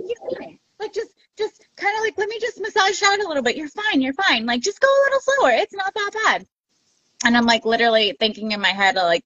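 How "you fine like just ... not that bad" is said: high-pitched friend's reassurance; quick, high, imitated comforting; retold soothing. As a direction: coax a hurting friend in a high voice.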